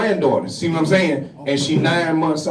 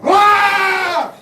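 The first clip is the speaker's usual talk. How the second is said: sudden high yelled "Rah!"; one explosive high shout of "Rah!"; 13 semitones higher, 4 dB louder than usual. Pretend. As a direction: let out a big release yell. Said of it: "let out a yell like".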